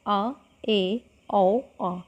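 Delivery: आ ए औ आ each sounded and held; vowel demonstration. Shown as a demonstration. Teacher demonstrates half-open vowels, clearly sounded. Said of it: "इनके उदाहरण है".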